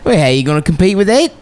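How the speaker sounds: quick mock Aussie commentary; lightly voiced Australian accent; Australian commentator. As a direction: fire it off like a crude broadcaster. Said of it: "pretending to be a racist Australian".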